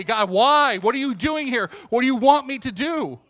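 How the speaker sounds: loud, high-pitched demanding questions; pleading with God. Acted. Acting out questioning God in prayer.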